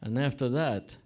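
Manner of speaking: thick mock Russian-Italian accent; deliberate mangled Russian English, pausing between phrases. Barber's honeymoon punchline to groom, mock Russian accent. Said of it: "like, a really fucked up accent".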